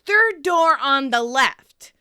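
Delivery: slow, loud called-out directions; mock yell at a roommate; retold yell. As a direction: shout it down the hall, slow and clear. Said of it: "Monica yells".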